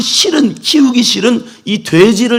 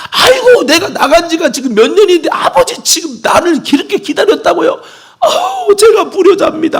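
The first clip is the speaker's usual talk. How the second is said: sobbing son; 10 semitones higher than usual. Acted. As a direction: break down crying, beg forgiveness. Son sobbing in father's arms.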